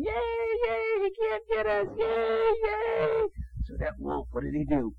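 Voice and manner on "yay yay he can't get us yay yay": high excited little pigs celebrating; squealing piggy cheer. Acted. Father reading to his child, voicing the piggies cheering safely inside. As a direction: squeal it out like happy piglets.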